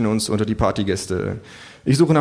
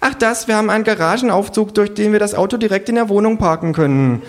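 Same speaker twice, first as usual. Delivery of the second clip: much higher, loud breezy show-off voice; trendy hostess; 11 semitones higher, 4 dB louder than usual. Acted. Hostess brags about her car lift, high and breezy. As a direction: brag it off casually.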